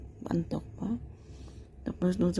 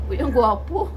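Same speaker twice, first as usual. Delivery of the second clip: laughing; 5 semitones higher than usual. Real, not acted. Laughing while praising cat.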